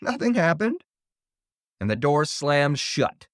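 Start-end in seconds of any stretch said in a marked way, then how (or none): 0.0-0.8 high, breezy lilt; giggly. A narrator's reading. Little sister stands inside the hut, high giggly reassurance. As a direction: pitch it up, playfully unworried. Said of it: "she giggled".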